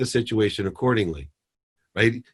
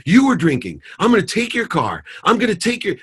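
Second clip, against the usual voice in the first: mock furious yell; 10 semitones higher, 3 dB louder than usual. Father yelling at his daughter about the car, mock furious. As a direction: pitch shoots higher in a mock rant. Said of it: "I would have yelled at her".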